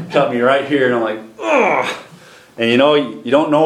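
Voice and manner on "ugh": high, falling "ugh"; acted groan. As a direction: groan as if cut to the quick. Acts out his gut reaction.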